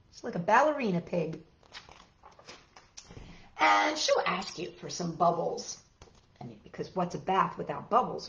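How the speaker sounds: normal talking voice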